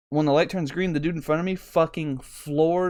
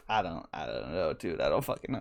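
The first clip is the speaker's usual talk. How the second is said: sheepish mumble; 10 semitones lower, 8 dB quieter than usual. Retold or imitated. Shrugging to laughing truck driver.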